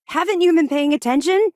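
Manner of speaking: high, loud, fed-up demand; exasperated rebuke. Acted. Officer scolding an inattentive captain, loud exasperated snap. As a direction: snap at them for not listening.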